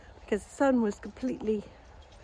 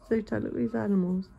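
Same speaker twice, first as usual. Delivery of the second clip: ordinary delivery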